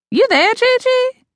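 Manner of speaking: mother checks in, high and slow; inquiring mother. Acted. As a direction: uncertain mother asking into the silence. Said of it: "my mother inquired".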